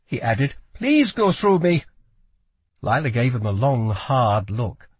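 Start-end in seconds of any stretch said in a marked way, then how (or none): 0.7-1.8 put-on high, accented, urgent plea; pleading boy. A narrator's reading. Boy guard asks Lila to fight him, pleading. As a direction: beg earnestly in a foreign boy's voice.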